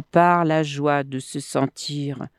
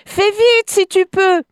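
high, loud urging shout; calling out; 6 dB louder than usual. A narrator's reading. Crocodile in the mud hurries the monkey, calling out. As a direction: call it up high and loud, hurrying him. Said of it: "lui cria Brèchedent".

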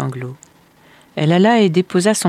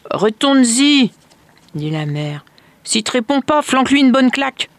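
curt stepmother; 8 semitones higher, 5 dB louder than usual. A narrator's reading. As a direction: brush the child off harshly.

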